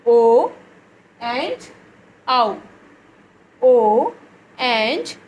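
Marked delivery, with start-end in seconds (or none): 0.0-0.5 "O" said loud and long; loud vowel O. Shown as a demonstration. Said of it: "two sounds". 3.6-4.1 "O" said loud and long; loud vowel O. Shown as a demonstration. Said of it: "two sounds".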